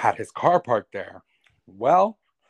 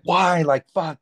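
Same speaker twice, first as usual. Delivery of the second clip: retold outburst, pitched up high and louder; mock angry retort; 7 semitones higher, 5 dB louder, slower than usual. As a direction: throw the exasperated "why" back at her like he did. Retold angry phone call with landlord.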